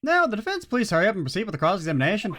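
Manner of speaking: high, fast impatient judge. Acted. Judge rushing the defense, high and fast. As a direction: hurry the defense along.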